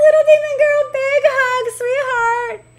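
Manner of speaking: very high, sing-song cooing; gushing affection. Real, not acted. Streamer sending a viewer big hugs, gushing affection. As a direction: coo it like a squealed hug.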